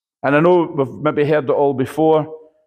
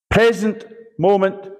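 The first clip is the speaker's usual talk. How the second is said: normal talking voice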